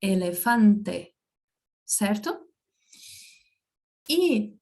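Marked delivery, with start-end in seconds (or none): 0.0-1.1 elefante said slowly, final e kept a clear e, not i; Spanish pronunciation. Shown as a demonstration. Teacher showing Portuguese speakers the Spanish sound, slow and careful. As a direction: stretch out elefante and end on a pure e. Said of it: "Então, ela seria".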